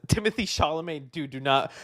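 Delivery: normal talking voice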